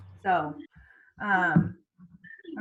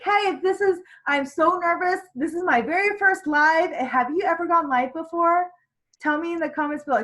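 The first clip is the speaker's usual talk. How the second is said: high, loud, gushy confession to viewers; demo live patter; 7 semitones higher, 5 dB louder than usual. Shown as a demonstration. Coach modelling a nervous first live, high and peppy. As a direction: play a first-timer chatting up the audience.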